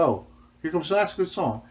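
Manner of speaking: wife softening. Retold or imitated.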